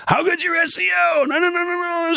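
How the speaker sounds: very high-pitched mock shout; mock badgering. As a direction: squawk it like a caricature of a nagging marketer. Mocks marketers obsessed with search metrics.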